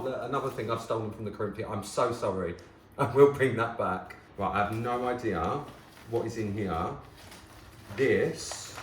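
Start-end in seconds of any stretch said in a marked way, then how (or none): none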